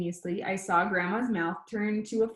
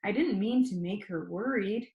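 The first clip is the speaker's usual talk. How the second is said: ordinary delivery, nothing marked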